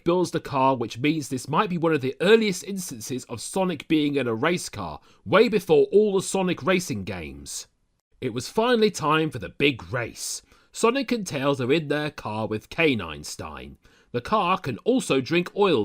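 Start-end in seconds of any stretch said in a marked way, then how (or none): none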